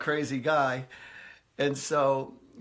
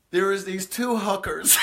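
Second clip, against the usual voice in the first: giggly, higher and louder punchline; laughing punchline; 6 semitones higher, 5 dB louder than usual. Retold or imitated. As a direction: choke back laughter while landing the joke. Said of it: "he goes".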